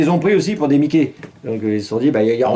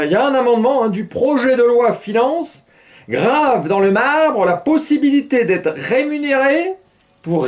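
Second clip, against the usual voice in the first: slow, higher anchor voice; news read; 10 semitones higher, slower than usual. Acted. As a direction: read the item like a newscaster. Reads news item.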